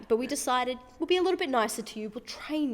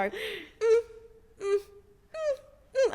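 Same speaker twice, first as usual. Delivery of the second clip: high squeaky whimpering hums; mouse noises; 11 semitones higher, much slower than usual. Retold or imitated. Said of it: "I go".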